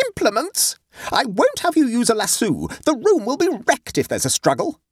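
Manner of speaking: high-pitched, alarmed indignation; scandalised outburst; outraged protest. A narrator's reading. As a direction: object in a high, agitated voice. Outraged Adela objects to his plan.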